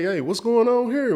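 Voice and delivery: high-pitched retold confrontation; retold angry challenge. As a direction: imitate an indignant bystander calling out. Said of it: "then he started cursing".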